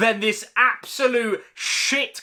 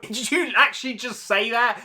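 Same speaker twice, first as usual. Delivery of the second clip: ordinary talk, nothing marked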